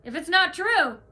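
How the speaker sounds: retelling an angry challenge; confronting. Retold or imitated. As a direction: demand an answer, fed up. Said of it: "I was like".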